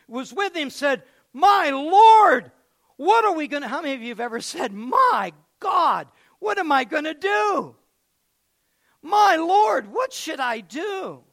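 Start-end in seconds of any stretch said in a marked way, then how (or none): 1.3-3.7 high, loud frightened cry; panicked cry. Acted. Servant crying out to his master in fear. 4.7-11.2 loud, high alarmed plea; panicked cry. Acted. Servant crying to the prophet in fear.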